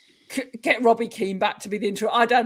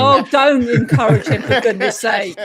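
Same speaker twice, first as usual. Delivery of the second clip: loud, laughing protest; amused scolding; 3 semitones higher, 9 dB louder than usual. Real, not acted. Cohost telling others not to encourage him, amused and loud.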